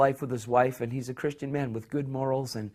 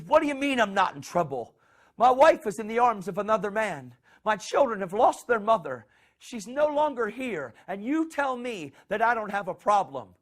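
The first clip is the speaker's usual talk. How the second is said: high, distressed protest, much higher; anguished outcry; 8 semitones higher than usual. Retold or imitated. Husband screams at God about his wife, high anguished. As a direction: cry out in disbelief at God.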